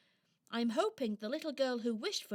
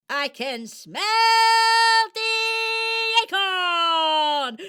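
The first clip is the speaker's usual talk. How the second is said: loud, higher cry that builds to a long drawn-out call; triumphant shout; 6 semitones higher, 10 dB louder, much slower than usual. Acted. Squirrel shouting to Elva as she sniffs out the acorn, triumphant and loud. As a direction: bellow the discovery, stretching the last words.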